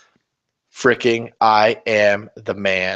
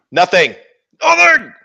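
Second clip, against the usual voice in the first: from raised to high, loud crying yell; building shout; 10 semitones higher, 7 dB louder than usual. Real, not acted. Ranting at the rival, rising to a loud cry. Ranting about dropped models.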